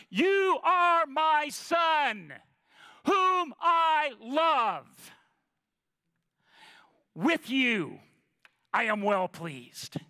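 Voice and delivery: slow, booming voice from heaven; voice of God thundering. Acted. Preacher voicing God from heaven, slow and booming. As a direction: proclaim it slowly like God from above. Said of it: "words thundered from above".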